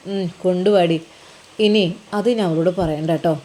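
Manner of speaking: a low, casual word to his wife; husband character. Acted. Husband asks wife for tea.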